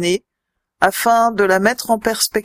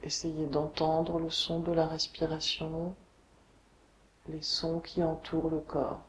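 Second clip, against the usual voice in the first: guided meditation; 3 semitones lower, 5 dB quieter than usual. Acted. Yoga teacher guiding listeners lying on the floor, meditation voice. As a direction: guide them unhurriedly into deep rest, letting phrases settle. Leads a Yoga Nidra relaxation.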